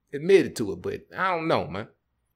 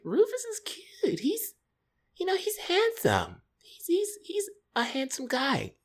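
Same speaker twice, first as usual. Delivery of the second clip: high, slow dreamy falsetto; swooning girlfriend; slower than usual. Retold or imitated. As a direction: imitate a girlfriend gushing, high and drawn out. Acting girlfriend praising his friend.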